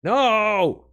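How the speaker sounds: loud, high shout of no; loud high frustrated yell. Real, not acted. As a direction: yell at the failure. Trick fails again.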